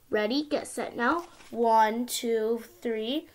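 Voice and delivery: sing-song storybook reading; reading to a toddler. Acted. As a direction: read it like a bedtime picture book. Child reads picture book aloud.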